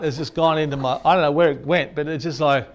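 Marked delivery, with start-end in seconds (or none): none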